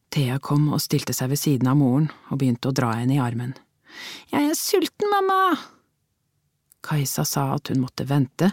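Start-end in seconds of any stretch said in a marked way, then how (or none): 4.3-5.6 put-on much higher whining little girl; hungry little girl whining at mum; whiny child. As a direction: whine like a hungry small child. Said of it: "den sutrete klangen i Theas stemme".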